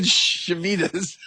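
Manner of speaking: very slow "Shemitas" with the syllables stretched; retelling how he struggled to say Shemitas, drawn slow. Retold or imitated. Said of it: "I said".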